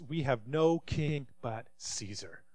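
a grave, hushed pledge to Caesar; quiet, slow declaration. Acted. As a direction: let "We have no king but Caesar" fall low and slow with stress on "no". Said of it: "The leading priest shouted back this".

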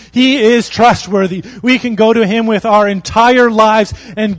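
loud, rising preacher's cadence; preaching at full force. Acted. Pastor to his congregation, preaching at full force.

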